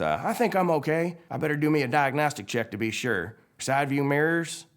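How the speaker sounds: high, put-on tow-truck voice; Mater. Mater does his self-check after lightning, high character voice. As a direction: run a checklist aloud in character. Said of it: "And Mater says".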